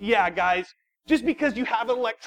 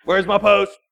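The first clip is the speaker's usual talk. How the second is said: louder demand for the post; demanding yell; 7 dB louder than usual. Retold or imitated. Angry pastor on the phone, louder demand. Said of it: "I'm like".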